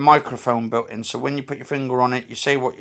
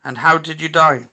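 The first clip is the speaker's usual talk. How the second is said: louder, projected question; raised voice, put the question to the room; calling out to spirits; 3 semitones higher, 7 dB louder than usual. Real, not acted. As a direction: ask the unseen spirit aloud.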